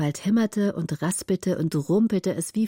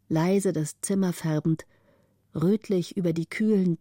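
ordinary delivery, nothing marked

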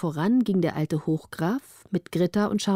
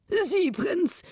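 high-pitched storybook king; high, eager king's voice; delighted king; 9 semitones higher than usual. A narrator's reading. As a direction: play a wonderstruck old-fashioned king, pitched up. King in a story speaking to prince.

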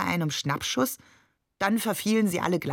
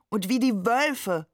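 called out; 5 semitones higher than usual. A narrator's reading. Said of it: "rief Ali".

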